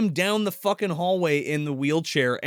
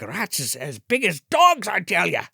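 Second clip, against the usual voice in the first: mock Irish accent; 2 semitones higher than usual. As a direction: draw it out in a put-on Irish accent. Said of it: "he's doing the Irish accent".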